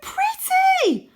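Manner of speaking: put-on very high, loud and slow boy voice; awed boy. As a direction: gush like an enchanted child. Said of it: "cries Wynne".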